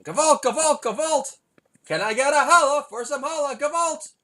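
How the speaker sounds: high, loud comic wail of "Gewalt"; mock outcry. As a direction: cry out "Gewalt" like a dramatic alarm. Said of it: "I'll say".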